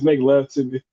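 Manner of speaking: mock-deep seductive line. Streamer reading chat, mocking a deep-voiced woman. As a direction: drop into a sultry put-on voice. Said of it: "she said in a deep voice".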